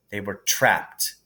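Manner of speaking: plain reading voice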